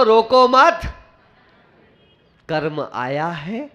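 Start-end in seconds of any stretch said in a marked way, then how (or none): 0.0-0.8 "रोको मत" joined, high and rising; no break between "रोको" and "मत", stop after it; comma after "मत". Shown as a demonstration. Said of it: "comma change कर दो".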